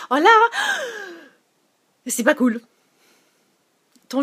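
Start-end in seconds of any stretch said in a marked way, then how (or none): 0.1-2.6 loud, high telling-off; mock scolding. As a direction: scold the kids sharply. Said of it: "Et là, tu dis". (parent qui reprend ses enfants, ton fort et aigu)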